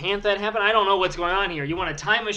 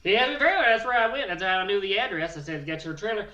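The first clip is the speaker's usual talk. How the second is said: rowdy hillbilly Bill bragging about his wedding news. Acted. Character Bill continues.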